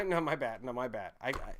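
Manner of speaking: ordinary talk, nothing marked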